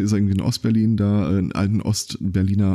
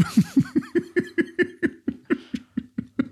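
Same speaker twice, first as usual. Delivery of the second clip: high airy laugh that falls away; breathless laugh; 4 dB quieter than usual. Real, not acted. Host laughing at the pub anecdote, high and airy.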